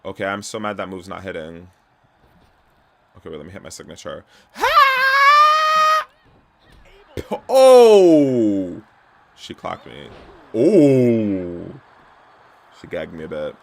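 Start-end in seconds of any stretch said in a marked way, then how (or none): 4.5-6.1 long, very loud high yell; high-pitched battle shriek. Real, not acted. Shouting while landing game move. 7.5-8.8 high, loud falling "oh"; loud startled cry. Real, not acted. Reacting to being hit in game. 10.5-11.8 high, loud drawn-out "ooh"; loud "ooh" cry. Real, not acted. Reacting to being hit in game.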